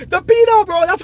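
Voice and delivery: laughing it out, loud and high; cracking up as the words come out; real laughter breaking through the words. Real, not acted. Cracking up at a viewer's joke.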